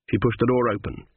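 plain reading voice

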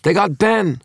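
high-pitched, full-volume alarm cry; panicked scream. A narrator's reading. Harris warning that Ben was got, screaming. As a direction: yell it out in panic. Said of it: "screamed Harris".

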